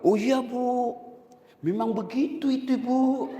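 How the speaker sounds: a hint of flattering the boss's wife; fawning agreement. Gossip pleasing the leader's wife, mildly fawning.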